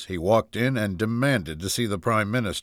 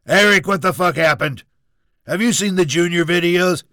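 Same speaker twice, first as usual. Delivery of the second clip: furious, high, loud demand; Donald Trump; 9 semitones higher, 8 dB louder than usual. Acted. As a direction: shout down the phone at your son. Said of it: "Donald Trump was furious".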